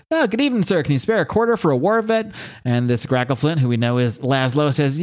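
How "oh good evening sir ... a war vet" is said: panhandler voice. Retold or imitated. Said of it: "He says".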